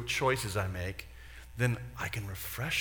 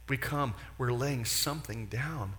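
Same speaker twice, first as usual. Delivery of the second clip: normal talking voice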